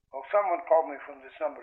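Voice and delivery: quick caller down a telephone line; thin phone-line voice, talking fast; brisk, tinny phone caller. A narrator's reading. Caller on the other end.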